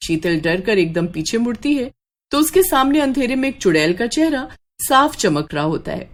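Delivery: plain reading voice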